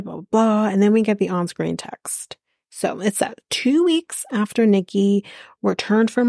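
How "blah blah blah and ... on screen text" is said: normal talking voice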